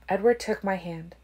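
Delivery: ordinary delivery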